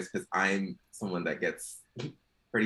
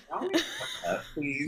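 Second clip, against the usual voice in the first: high exasperated plea; 6 semitones higher, 3 dB quieter than usual. Retold or imitated. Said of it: "I was like".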